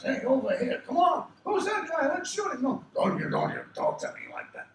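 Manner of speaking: high, loud cartoon tough guys calling out; imitating dad's many gangster-like voices; dad's character voices. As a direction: switch between shouted bit-part henchman voices. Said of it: "All the different voices my dad did".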